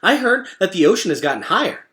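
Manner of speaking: quick excitable critter; Chit Chat's voice. Acted. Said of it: "said Chit Chat".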